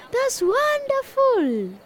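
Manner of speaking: delighted praise. Real, not acted. Teacher praises class.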